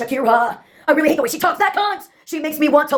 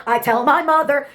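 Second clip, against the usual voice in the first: normal talking voice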